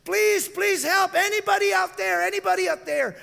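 high, loud pleading cry; yelling for help. Retold or imitated. Slipping man begs anyone out there, high pleading yell. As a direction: beg anyone to hear you. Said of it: "he started yelling".

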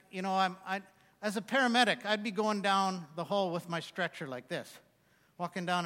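ordinary talk

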